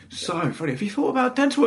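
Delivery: high-pitched mock doctor; acted doctor, pitched right up. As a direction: put on a high voice for a doctor's question. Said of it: "and they were like".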